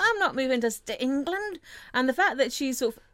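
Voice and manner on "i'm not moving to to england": high-pitched mock protest; imitated Scottish indignation; indignant Scotsman. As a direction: refuse like an appalled Scot. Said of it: "he's a Scotsman".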